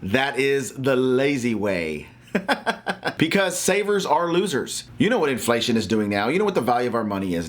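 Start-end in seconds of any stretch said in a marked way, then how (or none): 2.3-3.1 brief high chuckle; quick real laugh at own remark; short laugh. Real, not acted. Speaker amused after naming the lazy way, short laugh.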